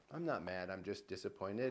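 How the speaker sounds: lightly voiced let-down father, low and soft; disappointed dad. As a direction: quietly imitate a dad's mild disappointment. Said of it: "when your dad's like".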